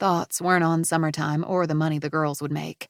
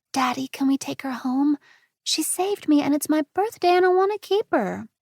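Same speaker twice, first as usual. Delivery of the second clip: put-on high chatty five-year-old; a child explaining her adventure; little girl; 9 semitones higher than usual. Angela's voice.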